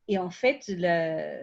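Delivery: ordinary talk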